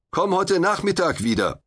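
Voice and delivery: defiant call from above; calling back down. A narrator's reading. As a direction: call down from the trapeze, brushing him off. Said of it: "rief ich".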